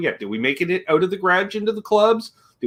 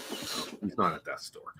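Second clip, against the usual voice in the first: whispered aside; 8 semitones lower, 17 dB quieter, slower than usual. Real, not acted. Joking aside corrected quietly.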